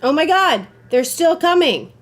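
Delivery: loud, high alarmed yell; panicked shout. Real, not acted. Player fleeing enemies, shouting in panic. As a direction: yell as they close in. Enemies still chasing him.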